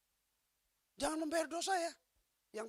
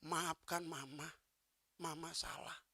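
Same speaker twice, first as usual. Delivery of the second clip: slow and soft, a faint last plea; dying wife's soft apology; 8 semitones lower, 13 dB quieter, slower than usual. Retold or imitated. Dying wife apologising to her husband, soft and slow. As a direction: voice fading, a dying woman asks forgiveness.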